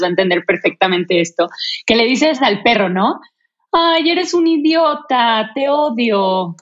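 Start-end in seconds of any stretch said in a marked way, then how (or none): 3.7-6.6 put-on slow, higher sweet baby-talk to a dog; fond slow higher coo to the dog; sweet doting tone. Shown as a demonstration. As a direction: coo the insult lovingly like praising a pet. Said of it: "con un tono de voz así súper lindo".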